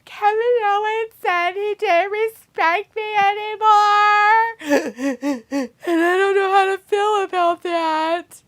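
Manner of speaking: high, slow whiny mock sob; whimpering like a sulking child; mock crying diary. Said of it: "be like".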